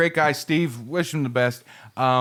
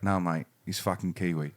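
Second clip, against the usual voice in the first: put-on low, soft Australian accent; 7 semitones lower, 8 dB quieter than usual. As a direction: imitate a laconic Australian mate. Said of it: "I said to the Australian".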